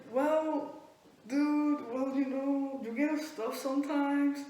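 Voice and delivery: sheepish friend. Retold or imitated. Friend excusing the accusation, sheepishly. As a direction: imitate meek self-justification. Friend meekly justifying herself.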